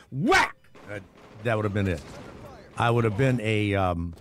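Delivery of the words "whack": mock gunshot sound. Sniper hitting the soldier.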